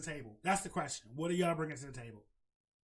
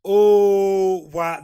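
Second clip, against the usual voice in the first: much louder, stretched out gasp of wow; loud shocked oh wow; 2 semitones higher, 17 dB louder than usual. Real, not acted. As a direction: blow up at the news. Reacting loudly in shock.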